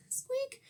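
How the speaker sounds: tiny high squeak, very soft; mouse squeak. Acted. Mouse squeaking nervously before the lion, tiny and high. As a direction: let a little squeak slip out.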